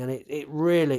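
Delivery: ordinary delivery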